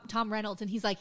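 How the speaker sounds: ordinary talk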